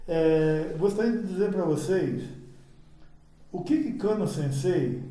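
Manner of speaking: ordinary delivery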